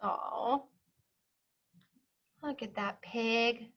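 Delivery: ordinary talk, nothing marked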